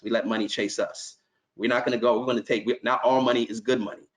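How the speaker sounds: usual speaking voice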